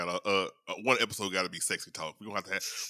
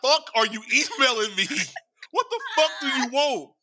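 much higher, louder angry rant at work emails; hammed-up fury at the boss; mock rant; 7 dB louder than usual. Venting about work emails, high and loud mock anger. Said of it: "I just like".

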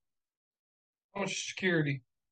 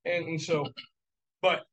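ordinary talk, nothing marked

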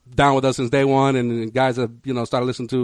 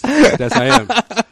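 laughing; 9 semitones higher, 4 dB louder, much slower than usual. Retold or imitated. Laughing while retelling.

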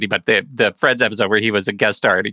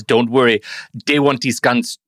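imitated South African film accent; Leo DiCaprio. Podcaster quoting a jungle smuggler, mock South African accent. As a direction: put on a movie-style Rhodesian accent. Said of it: "his South African accent in Blood Diamond".

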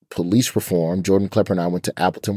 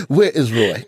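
high, laughing fake African accent; half-hearted African accent through laughter; 4 semitones higher, 4 dB louder than usual. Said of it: "That's my African accent".

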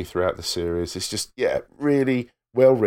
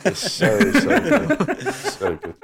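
gushing; 12 semitones higher, 4 dB louder, slower than usual. Real, not acted. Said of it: "gushing about the show".

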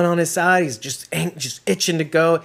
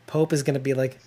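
ordinary delivery

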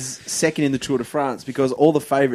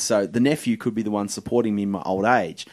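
usual speaking voice

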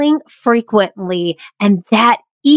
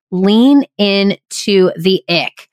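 ordinary delivery

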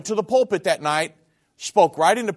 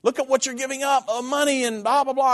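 mocking relatives; 8 semitones higher than usual. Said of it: "they just thought I was the stupidest".

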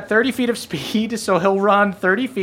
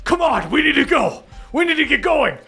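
higher, pressing dwarf hurrying her on; urgent dwarf voice; 9 semitones higher than usual. Acted. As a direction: push her to move, now. Dwarf urging Beverly to move.

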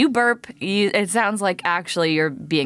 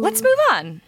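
squeaky, eager let's go; high eager squeal. Real, not acted. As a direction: jump in high and bright. Eager to change topic.

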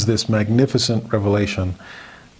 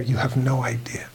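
soft, half-whispered aside; hushed; 5 dB quieter than usual. Acted. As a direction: let it drop almost to a whisper. Voicing the Lord's suffering, hushed.

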